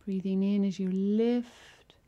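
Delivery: ordinary delivery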